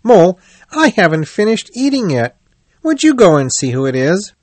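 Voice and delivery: a busy rat asking a favour, hurried. A narrator's reading.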